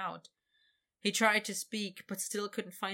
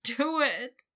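begging; 6 semitones higher, 7 dB louder than usual. Acted. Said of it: "Victor begged".

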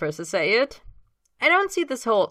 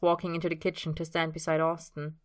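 usual speaking voice

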